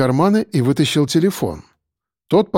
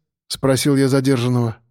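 ordinary delivery

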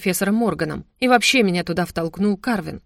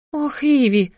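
higher, louder anxious cry; alarmed gasp; 7 semitones higher, 3 dB louder, slower than usual. A narrator's reading. Worried mother cries out to her daughter, alarmed. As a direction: clutch your chest and cry her name. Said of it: "взволнованная мама".

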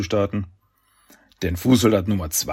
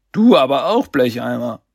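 laut und hoch zurückgeben; freches Kontern; 4 semitones higher, 6 dB louder than usual. A narrator's reading. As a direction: die Beleidigung kräftig zurückschleudern. Don kontert den Schurken. (lauter, frecher Konter)

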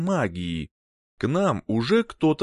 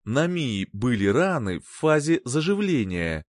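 plain reading voice

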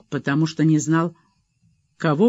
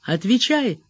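a higher, insistent command; sharp, raised order; demanding; 8 semitones higher than usual. A narrator's reading. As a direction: demand the answer now. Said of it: "потребовал Вадим".

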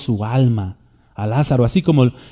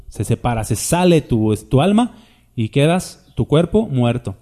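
ordinary talk

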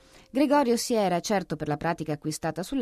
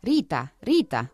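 loud call to the daughter; yelled; 4 dB louder, slower than usual. Acted. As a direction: shout the name across the flat. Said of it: "urlò poi".

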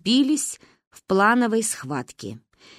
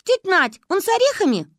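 put-on high, louder eager child; eager question; 12 semitones higher, 4 dB louder than usual. A narrator's reading. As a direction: ask hopefully. Girl eager about cake.

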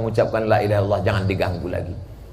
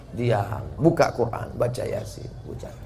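hushed instruction; 7 dB quieter than usual. Acted. Calming the family at a deathbed.

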